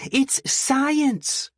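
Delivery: high, thin, near tears; breathy thin voice. Acted. Delphi answers officer, thin and tearful. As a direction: protest on the verge of tears. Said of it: "breathy, thin voice".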